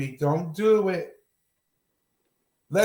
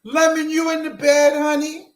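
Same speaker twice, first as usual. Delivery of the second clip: loud, higher sing-out to someone asleep; calling out; 6 semitones higher, 5 dB louder than usual. Real, not acted. Checking if Lemon is in bed, calling out. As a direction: call through to a sleeping sweetheart.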